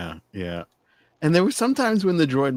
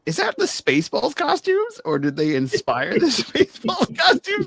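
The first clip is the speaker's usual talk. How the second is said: incredulous; 4 dB louder than usual. Retold or imitated. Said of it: "It was like".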